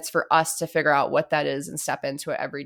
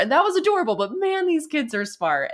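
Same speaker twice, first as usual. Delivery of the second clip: excited; 11 semitones higher than usual. Real, not acted. Repeats line, laughing high.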